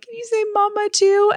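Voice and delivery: put-on high sing-song baby talk; mother coaxing her baby to say mama. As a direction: coax a baby sweetly, way up high.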